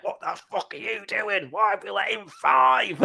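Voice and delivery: mock a Geordie captain raging through gritted teeth; Geordie accent. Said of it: "That horrible Geordie accent".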